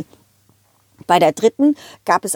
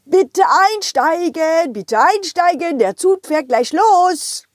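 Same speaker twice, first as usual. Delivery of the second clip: slow, higher announcer call; train conductor call; 7 semitones higher, slower than usual. Acted. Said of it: "conductor announcement".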